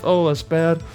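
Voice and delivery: ordinary talk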